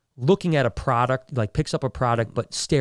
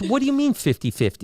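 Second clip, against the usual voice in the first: high, alarmed put-on voice; alarmed partner; 13 semitones higher than usual. Partner questions the fixer, high and alarmed. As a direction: squeak it out in alarm. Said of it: "he goes, he goes".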